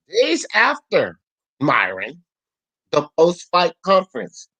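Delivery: slow and deliberate, word by word; over enunciating. Shown as a demonstration. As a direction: spell it out slowly with pauses. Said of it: "let me say it again slowly".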